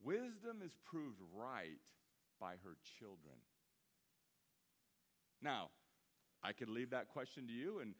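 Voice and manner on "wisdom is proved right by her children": slowed and lowered, each word weighed; preacher rereading the verse slowly for the flock; slow, weighty reading. Shown as a demonstration. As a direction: let "Wisdom is proved right" sink in slowly. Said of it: "What do you think that means?".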